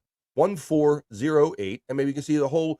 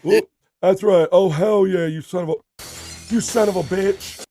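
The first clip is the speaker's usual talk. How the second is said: hell yeah shout; 8 semitones higher, 9 dB louder than usual. Real, not acted. Host cheering for a friend, loud and high. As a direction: roar it out in celebration. Celebrating a chat member.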